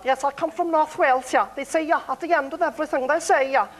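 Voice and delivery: high-pitched North Wales accent; Anglesey accent. Shown as a demonstration. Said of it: "in North Wales, um, Anglesey".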